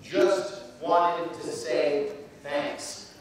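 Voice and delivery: slow and even, each word laid out; slow, simple thanks. Shown as a demonstration. Speaker showing students the message again, slow and simple. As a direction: model the message slowly. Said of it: "Just right".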